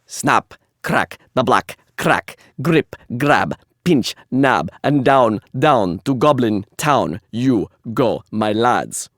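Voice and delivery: put-on slow, heavy beats in a Russian accent. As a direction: chant each word hard and separately, Russian-accented. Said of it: "a little bit of a Russian accent".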